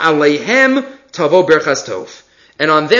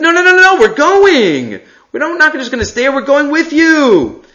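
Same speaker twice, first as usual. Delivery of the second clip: eager protest; 9 semitones higher than usual. Retold or imitated. Tribes assuring Moshe they will go, eager and high. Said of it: "They said".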